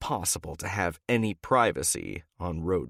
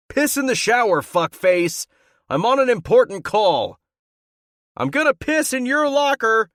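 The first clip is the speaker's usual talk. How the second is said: much higher, much louder furious yelling; shouting back; 10 dB louder than usual. Acted. He shouts back at his teammate from the bathroom, loud. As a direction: yell him off through the door.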